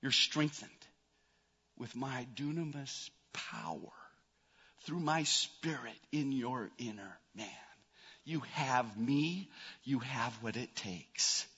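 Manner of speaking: very soft, low, near-whispered reassurance; God's quiet answer. Retold or imitated. God answering his children's doubt, hushed and assuring. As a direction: speak slowly and hushed, pausing between phrases. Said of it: "his answer".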